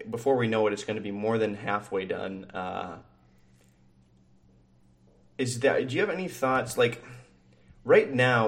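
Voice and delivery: usual speaking voice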